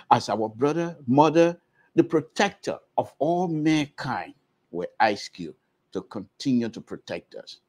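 solemn prayer with pauses between phrases; spoken prayer. Real, not acted. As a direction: lead the listeners in earnest petition, phrase by phrase. Leads an opening prayer.

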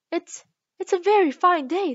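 high, hesitant pleasantry; timid. A narrator's reading. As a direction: venture it nervously, with a stumbled start. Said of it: "said a timid voice".

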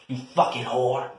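ordinary talk

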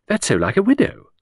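lilting high society lady; chattering aristocrat. Acted. Hostess prattling to Edward about the wedding. Lucy objecting to Midge.

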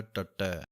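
ਟੱਟੈ with doubled retroflex t, not ਟੈਂਕਾ; correct tattai. Shown as a demonstration. Teacher giving the pure Gurbani sound of ਟੱਟੈ. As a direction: say ਟੱਟੈ as ṭaṭṭai. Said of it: "ਇਹਦਾ ਮੁੱਖ ਉਚਾਰਨ ਟੱਟੈ ਇਸੇ ਤਰ੍ਹਾਂ".

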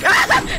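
very high, loud panicked shrieks; real frightened yelps; terrified shriek. Real, not acted. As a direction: let out real startled screams. Chased in horror game.

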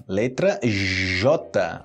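"letra J" drawn out very slowly; letter J slowly. Shown as a demonstration. Teacher slowly spelling the letter J for learners. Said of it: "começa com J".

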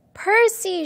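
high, loud cry of relief; mother crying out her son's name in relief; joyful cry. Acted. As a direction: call his name out, overjoyed to see him. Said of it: "she cried".

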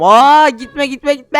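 high, loud, drawn-out panicked yell; panicked shout. Real, not acted. Gamer yells as a rare item slips away, panicked. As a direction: stretch it out in alarm, begging it not to go.

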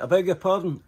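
mock polite policeman not understanding; puzzled English cop. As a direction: sound baffled like a proper English copper. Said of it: "And he went".

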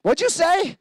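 high, startled question; stunned, asking the kid to repeat; taken aback by the kid's words; surprised. Retold or imitated. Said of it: "And I'm like".

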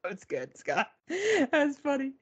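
high, drawn-out words through laughter; helpless laughter in the voice; laughing. Real, not acted. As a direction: still laughing, squeal it out slowly. Reacting amid a laughing fit.

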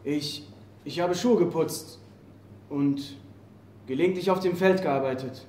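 cowed prisoner answering his captor softly; frightened murmur. Acted. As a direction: barely dare to speak.

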